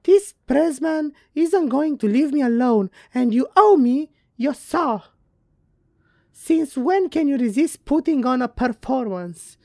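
high, loud, over-the-top letter reading; theatrical high-pitched character, all over the place; Maggie's letter voice. Acted. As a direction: declaim the letter in a high put-on voice. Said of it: "what's with up with that voice".

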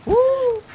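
very high loud woo; real hype whoop; excited whoop. Real, not acted. Hype cheer in fight.

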